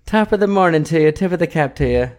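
put-on half-hearted Irish accent; shitty Irish accent. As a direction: attempt a bad Irish brogue. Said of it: "best I can fucking do".

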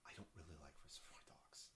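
hushed confession; whispering. Real, not acted. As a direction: whisper it like a guilty secret. Confessing an unpopular opinion.

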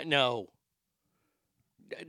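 usual speaking voice

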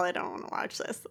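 normal talking voice

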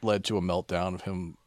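ordinary delivery

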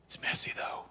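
whispered aside. Acted. Audience-voiced doubt, whispered.

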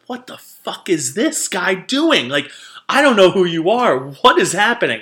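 loud, high imitation of a bewildered friend; baffled outburst. Tom's baffled reaction, acted out.

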